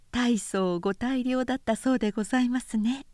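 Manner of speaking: put-on light, quick, smiling young woman's voice; a lightly voiced woman teasing with a smile; smiling Miyo. A narrator's reading. Miyo teases the visitor with a smile, lightly voiced. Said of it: "と美代は微笑しながら言った".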